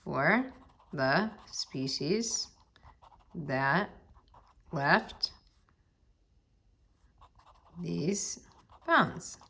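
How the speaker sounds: slow, word by word with long pauses; copying out aloud. Real, not acted. Teacher copying the last sentence, halting dictation. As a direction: voice each word as you write it. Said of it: "I'm just copying this last sentence".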